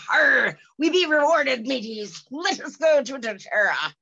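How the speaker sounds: loud hammy pirate growl. Pretend. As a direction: ham it up like a swashbuckling pirate. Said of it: "I sound like a pirate".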